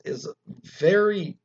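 usual speaking voice

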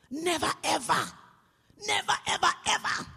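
rising, rough-edged comic rant; mock furious outburst. Flustered mother to company calling her at work, hammed-up fury.

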